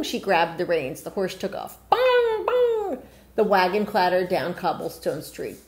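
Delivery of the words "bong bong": clock chime. Acted. Clock chiming.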